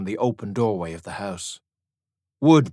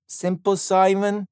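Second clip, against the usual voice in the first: put-on much higher, slow teasing girl voice; 10 semitones higher than usual. A narrator's reading. Girl to Simon, high and teasing. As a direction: tease him playfully.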